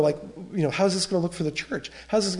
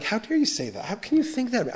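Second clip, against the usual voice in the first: retold anger; 7 semitones higher than usual. Pastor answering his accusers in his head, indignant. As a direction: imitate affronted disbelief, voice lifted. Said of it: "experiencing this, you know, anger".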